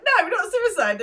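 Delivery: high, loud alarmed denial; alarmed protest. Retold or imitated. A podcaster voicing Mark's alarmed reaction, high and loud. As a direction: yelp it out in shocked protest. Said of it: "Mark's reaction where he's like".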